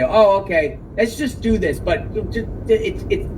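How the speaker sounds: lightly voiced pushy persuader, hurrying at the end; smooth-talking guy. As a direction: imitate a guy brushing off worries. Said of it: "one of those type of guys that say".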